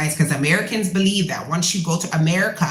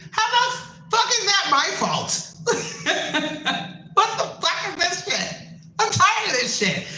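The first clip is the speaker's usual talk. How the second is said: very high, incredulous squeal through laughter; exasperated laughing; 12 semitones higher than usual. Real, not acted. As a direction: protest helplessly while cracking up. Exasperated rant breaking into laughter.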